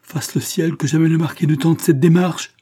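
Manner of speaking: high, loud cry of alarm; horrified plea. Acted. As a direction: pray in dread that it never happens. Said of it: "sembla épouvanter Valentine".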